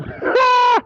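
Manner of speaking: a shrieking burst of laughter, very high; high laughing fit. Real, not acted. Streamer mocking his rival, a shrieking burst of laughter.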